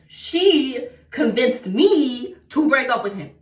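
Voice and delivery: slow, with "She" and "me" stretched out; pointed emphasis. Real, not acted. Telling viewers the best friend convinced them, pointed emphasis on "She". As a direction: lean hard on "She" and "me", word by word. Stressing who caused the breakup.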